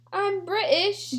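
slow, high mock British accent. Reading a tweet about Brits, mock British accent. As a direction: put on a drawn-out British accent. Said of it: "British people be like".

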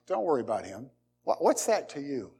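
a quiet, calm correction from above; the Lord answering. Retold or imitated. The Lord answering Peter about John, low and soft.

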